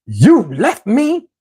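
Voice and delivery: slow, high mock scolding; imitating an irate woman, word by word; going off. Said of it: "she starts going off on me".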